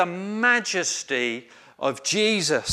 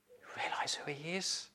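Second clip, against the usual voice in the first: whisper; 15 dB quieter than usual. Real, not acted. Preacher drops to a hushed question.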